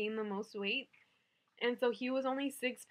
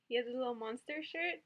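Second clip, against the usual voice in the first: normal talking voice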